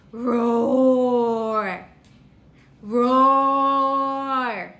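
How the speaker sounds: long, low playful roar; lion roar. Pretend. Teacher showing a toddler the lion's noise, long playful roars. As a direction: draw out a friendly lion roar. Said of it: "A lion makes a roaring noise".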